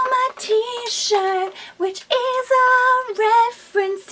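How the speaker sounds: high sing-song fangirl gushing; over-the-top gushing. Real, not acted. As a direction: gush dramatically, half singing. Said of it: "That was excessive".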